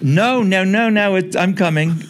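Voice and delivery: high, loud hurried reply from afar; calling back. Retold or imitated. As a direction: shout back that you're coming.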